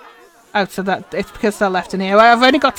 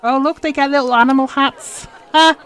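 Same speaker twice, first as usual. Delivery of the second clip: excited; 6 semitones higher, 4 dB louder than usual. Real, not acted. Delighted at animal hats.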